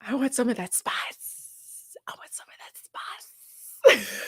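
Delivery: slow, drawn-out stage whisper; mock lustful whisper. Streamer imitating contestant craving spice, hammed-up whisper. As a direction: whisper it slowly, savoring each word.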